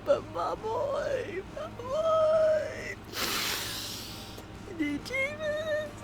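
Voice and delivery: tiny, very high, soft squeal; overjoyed squeal. Real, not acted. Fan gushing over a gifted book, overjoyed squeal. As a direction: squeak it out softly in sheer delight.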